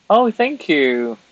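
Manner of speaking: high, loud, slow "oh"; delighted thanks. Real, not acted. Streamer thanking a viewer, delighted. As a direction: gush a delighted thank you.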